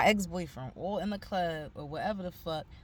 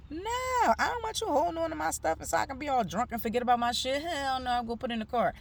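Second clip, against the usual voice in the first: indignant refusal; 7 semitones higher than usual. Retold or imitated. To the security guard, refusing to hand over her knife, indignant. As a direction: scoff at the offer and refuse it outright.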